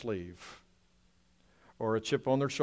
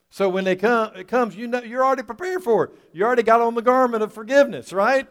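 higher and louder, quickening preaching; rising preacher climax; 10 semitones higher, 9 dB louder than usual. Acted. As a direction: build the congregation up fast.

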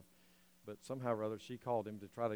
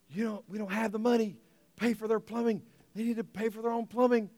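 a hint of an objecting mother, pitched higher; imitating mom; 8 semitones higher, 5 dB louder than usual. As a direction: lightly voice mom's complaint. Mom voiced in retold story.

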